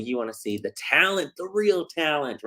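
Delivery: ordinary talk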